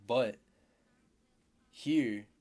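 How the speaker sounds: loud, punched emphasis; emphatic. Real, not acted. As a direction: hit these words hard. Teacher stressing a contrast.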